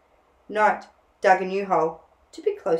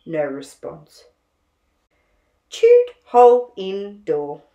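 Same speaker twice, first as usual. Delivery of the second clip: put-on unhurried reading for children; storybook reading; 5 dB louder than usual. A narrator's reading. Narrator reading a picture book to children, gently paced. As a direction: read a picture book aloud to little ones.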